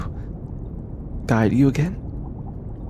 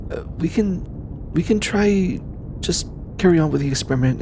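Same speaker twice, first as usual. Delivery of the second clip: higher, stumbling restart; flustered; 9 semitones higher than usual. Acted. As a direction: backpedal awkwardly, pitch jumping up. Flustered backtracking after awkward word.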